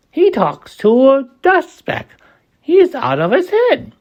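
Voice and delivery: high taunting gossip; gossiping jungle creatures. A narrator's reading. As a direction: jeer like chattering monkeys. Jungle gossip mocks Horton.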